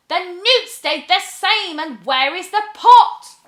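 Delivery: high, loud fuming question; exasperated witch. Acted. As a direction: demand it in rising fury. Witch losing temper.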